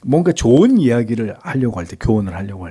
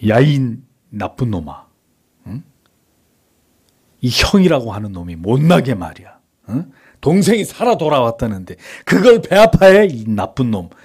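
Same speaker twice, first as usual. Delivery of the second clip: angry scolding; 3 semitones higher, 4 dB louder than usual. Acted. Imagined father berating his jealous elder son, angry scolding. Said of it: "이렇게 말하지 않았습니다".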